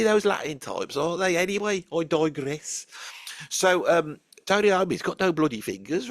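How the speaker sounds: ordinary talk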